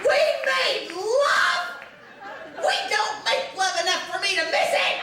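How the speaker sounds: high, shrieked question and retort; hammed-up incredulous outburst; shocked disbelief. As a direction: screech it in outraged astonishment. Outraged disbelief at wife's claim.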